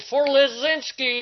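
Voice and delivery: high, half-shouted war cry; mock battle cry. Boys charging from the rubble, high mock battle cry.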